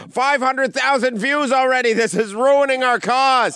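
high, loud whiny panic; mock panicked comics. Mocking upset Canadian comics, high loud whine. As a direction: wail it like offended comics. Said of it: "they're like".

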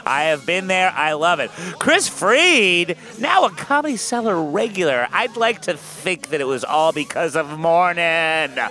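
slow, loud announcer build-up; show host intro. Acted. Host introduces the guests to the audience: announcer hype.

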